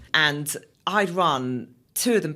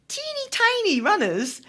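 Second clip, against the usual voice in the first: tiny voice; 5 dB louder, slower than usual. Retold or imitated. Teeny tiny runners seen far off.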